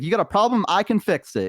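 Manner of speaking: high, lightly Boston goblin salesman; goblin Boston accent. Retold or imitated. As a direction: pitch his fix-it line with a Boston accent. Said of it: "they had, like, a Boston accent".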